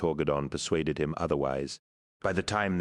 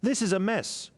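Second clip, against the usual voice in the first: character voice. A narrator's reading. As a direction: complain mid-battle. Character in battle.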